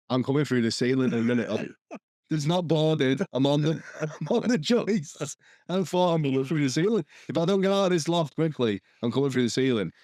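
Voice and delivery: retold panic. Re-enacts panic in the loft.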